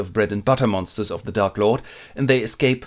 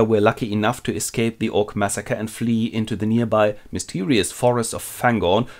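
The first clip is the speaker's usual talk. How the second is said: ordinary talk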